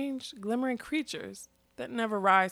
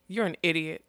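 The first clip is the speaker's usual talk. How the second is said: usual speaking voice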